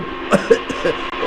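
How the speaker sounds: coughing from paint spray; spluttering cough. Acted. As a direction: choke on the spray.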